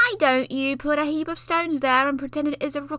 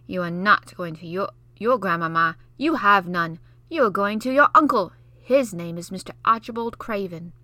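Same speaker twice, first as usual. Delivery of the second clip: put-on know-it-all boy; 2 semitones higher, 7 dB louder than usual. Basil lecturing Mary, know-it-all scorn. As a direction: lord it over her.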